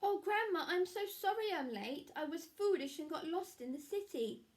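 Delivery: put-on a small girl owning up to Grandma; sorry little Red. A narrator's reading.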